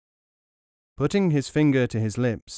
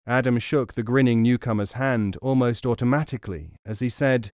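ordinary delivery

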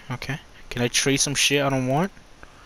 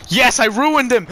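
high, loud triumphant cheer; excited cheer; 8 semitones higher, 5 dB louder than usual. Real, not acted. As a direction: shout it out in triumph. Celebrating a hit in game.

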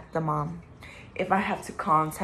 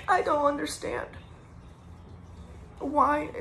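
tearful; 8 semitones higher, 5 dB quieter than usual. Real, not acted. Grieving, voice raised and strained.

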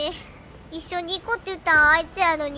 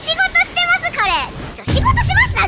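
high, loud frantic pleas; panicked calling; 13 semitones higher, 9 dB louder than usual. Real, not acted. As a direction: yell for help in a panic. 捕まってオーガに助けを求める. (高く大きな声で慌てて助けを呼ぶ)

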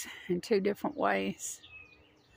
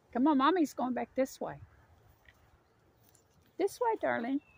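pet talk; 5 semitones higher than usual. Real, not acted. Coaxing her dog back.

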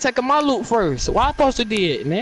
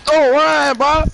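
loud, high shout after him; yelling don't run; 6 semitones higher, 6 dB louder, slower than usual. Real, not acted. Gamer shouting at an opponent running away, loud.

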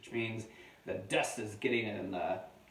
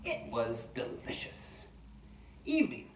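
slow, amateur Australian diary voice; attempted Australian accent; 11 semitones higher, 4 dB louder, slower than usual. Adult reading a wombat's diary to children, slow pretend Australian accent. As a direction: draw out each entry, playing at Australian. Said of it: "I'm trying to do my Australian voice".

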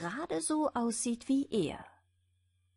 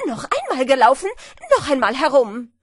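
much louder, fast furious yell; furious shout; 3 semitones higher, 12 dB louder, faster than usual. A narrator's reading. The furious hare screams for another race, loud and fast. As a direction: yell it, beside yourself with anger. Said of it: "ganz außer sich vor Ärger und schrie".

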